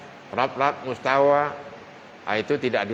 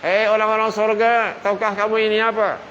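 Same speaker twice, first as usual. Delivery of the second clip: high, loud angel's proclamation; angel calling out; 10 semitones higher, 5 dB louder than usual. Acted. Angel calling out to the people of paradise, high and loud. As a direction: announce it from on high, voice raised and lifted. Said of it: "Bercakap malaikat".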